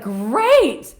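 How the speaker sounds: loud, high, gushing; overjoyed to be rid of him; mock delighted relief. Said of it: "And I was like".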